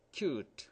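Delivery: "cute" said as kyoot; long u in cute. Shown as a demonstration. Teacher contrasting "cute" with "cut". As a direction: glide the U into "cute". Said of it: "Not cut".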